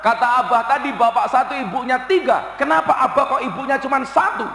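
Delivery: put-on a child calling mother, then asking in a rush; hint of a puzzled child; lightly voiced child. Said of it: "Anak saya bilang".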